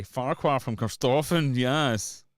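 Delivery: put-on high, loud Edinburgh accent caricature; mock Corstorphine accent. Streamer hailing Farquhar from Corstorphine, loud mock Edinburgh accent. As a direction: announce it in a hammed-up Corstorphine accent. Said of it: "mental accents".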